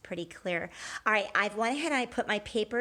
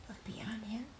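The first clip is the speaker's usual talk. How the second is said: whisper; 14 dB quieter than usual. Acted. Suspense whisper to children.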